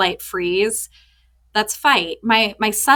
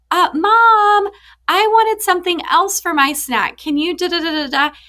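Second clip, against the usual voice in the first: put-on much higher, louder whiny kid voice; kid asking mom; 10 semitones higher, 5 dB louder than usual. Mom imitates son asking for another snack, high whiny voice. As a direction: put on the son's voice, high and pleading. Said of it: "and he's like".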